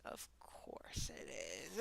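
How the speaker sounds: slow, hushed aside; very soft slow whisper. Real, not acted. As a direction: drag it out under the breath. Resigned aside, said twice.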